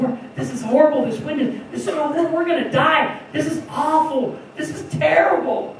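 high, loud, frantic cries of doom; rising panic, voice climbing high and loud; panicking disciples. Retold or imitated. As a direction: play the terrified disciple bailing water. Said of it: "here's the disciples panicking".

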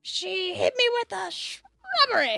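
Python quote voice. Retold or imitated. Monty Python quote, high slow.

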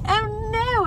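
teasing dramatic owner; repeated mock panic. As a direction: echo the owner's fright again. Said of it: "That's what it seemed like".

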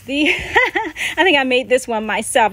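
sheepish laugh while admitting it. Real, not acted. Showing homemade glove, laughing.

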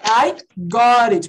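"I got it" drawn out slowly, word by word; demonstrated pronunciation. Shown as a demonstration. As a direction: stretch "I got it" slowly for the class, T tapped. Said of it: "Mas olha". ("I got it" bem devagar e destacado)

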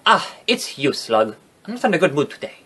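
soft, badly faked British accent. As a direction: put on a poor British accent. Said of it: "that's not a good British accent".

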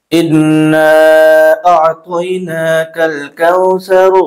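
slow, drawn-out chanted recitation; Quran recitation. Acted. Teacher recites the whole surah for students, slow tajweed chant. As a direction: recite the surah slowly, every letter clear.

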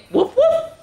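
high, loud playful woof; puppy bark. Shown as a demonstration. Teacher showing kids the puppy bark, high and loud. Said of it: "you're gonna go".

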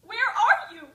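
very loud high wail; anguished cry. Acted. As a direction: cry out into the emptiness. Crying out to dead father.

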